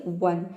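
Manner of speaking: "वन" opening on a W glide. Shown as a demonstration. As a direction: say "वन" starting with a "wuh" sound. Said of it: "ओ को व pronounce". ("वन", शुरू में व की ध्वनि)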